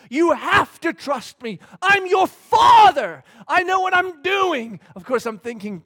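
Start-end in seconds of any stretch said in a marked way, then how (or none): 0.1-4.8 much higher, louder and slow, firm fatherly shout; insisting dad. Retold or imitated. Dad insisting to his scared daughter, slow loud insistence. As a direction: lay down the law. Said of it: "dad insisting to daughter".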